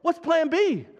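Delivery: high, hammed-up panicked voice; mock panic. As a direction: play a God who is flapping about, in fun.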